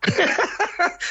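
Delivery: high breath-filled laugh; amused outburst of laughter; real burst of laughter. Real, not acted. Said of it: "for laughing".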